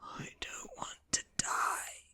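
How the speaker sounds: weak, whispered dying moan; soft, breathy plea of a dying man. A narrator's reading. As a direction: moan it slowly, close to tears. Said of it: "he moans".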